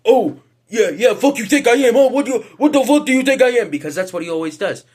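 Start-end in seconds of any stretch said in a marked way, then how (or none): 0.1-3.7 high, loud mock tough-guy rant; Raja Jackson acting tough. Raja Jackson confronting the wrestler who hit him, hammed-up tough talk. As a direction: puff it up into a loud, high-pitched challenge. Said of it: "Raja Jackson starts acting all tough".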